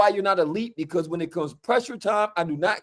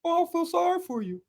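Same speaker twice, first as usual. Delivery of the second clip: high, softer fake-pity voice; mocking Eagles fan; 10 semitones higher, 5 dB quieter than usual. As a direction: mimic a smug fan pretending to feel sorry. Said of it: "You know what they tell me, the Eagles fans?".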